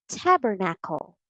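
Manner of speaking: tabernacle as TAB-er-nackle; short A. Shown as a demonstration. Teacher offers the third reading, short A. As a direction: say tabernacle with "tab" stressed up front. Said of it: "How do you read this?".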